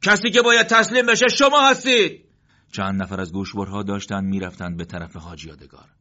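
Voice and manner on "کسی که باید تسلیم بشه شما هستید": very high, loud battle shout; defiant shout. Acted. Morteza shouting back at the enemy while firing. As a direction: yell it back as you fire. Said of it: "فریاد زد".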